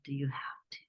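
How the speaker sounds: soft pleading whisper; whispered plea. Retold or imitated. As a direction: beg quietly, hoping to get out of it. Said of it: "And I'm like".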